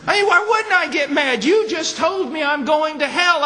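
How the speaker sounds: very high, loud outraged echo; angry man again. Retold or imitated. Preacher echoing the angry man's protest, high and loud. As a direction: hammer his outrage back at the room. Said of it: "He got very mad at me".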